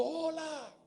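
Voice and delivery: high calling shout across the canyon; a father hollering hello. Retold or imitated. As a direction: call it out to the far mountain. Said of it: "el padre de pronto gritó y".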